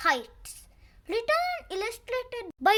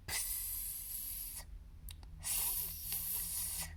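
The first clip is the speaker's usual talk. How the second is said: whispered hiss; 23 dB quieter than usual. Acted. Sneaky sound effects.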